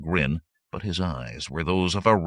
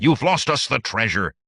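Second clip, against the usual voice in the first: loud, raised accusing voice; angry snarl; 6 semitones higher, 7 dB louder than usual. A narrator's reading. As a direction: snarl the blame at him. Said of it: "someone snarled".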